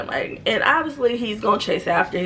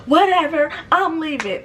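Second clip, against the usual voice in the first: high huffy brush-off; huffy exit; 8 semitones higher than usual. Retold or imitated. Sister-in-law storms out, high huffy voice. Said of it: "And she's like".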